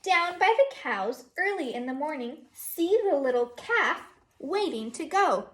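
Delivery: teacher reading Down by the Barn to her class, sing-song; reading to children. Acted. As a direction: read it like a storybook for little kids. Reads picture book aloud.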